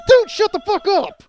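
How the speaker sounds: high, loud, drawn-out outburst; exasperated yell. Acted. To the stranger spoiling Inception, exasperated yell. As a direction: explode at someone who won't stop talking.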